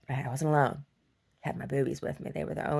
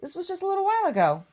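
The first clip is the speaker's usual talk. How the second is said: much higher, bright correction; high pet talk; 7 dB louder than usual. Real, not acted. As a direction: chirp it out high. High voice toward dog.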